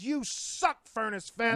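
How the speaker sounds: high-pitched mock outrage. Real, not acted. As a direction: yell it up high in disgust. Ranting at festival, high.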